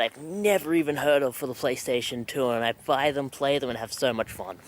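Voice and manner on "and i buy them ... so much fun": ordinary delivery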